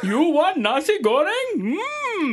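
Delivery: hammed-up Indian shopkeeper accent.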